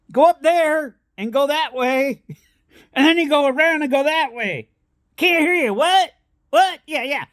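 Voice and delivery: high-pitched mock yelling of a deaf local; hard-of-hearing yelling guy. Half-deaf stranger yelling directions at dad, high mock holler. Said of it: "and this guy's yelling at him".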